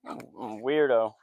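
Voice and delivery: ordinary talk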